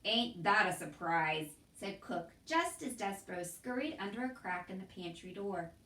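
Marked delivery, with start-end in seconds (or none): 0.0-1.6 dry sarcasm. A narrator's reading. Cook's sarcasm.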